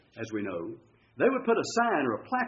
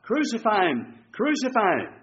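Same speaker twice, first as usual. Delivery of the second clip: high, mildly raised mob cry; retold crowd chant, pitched up; 6 semitones higher than usual. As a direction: imitate a crowd calling for blood. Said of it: "And they cry out".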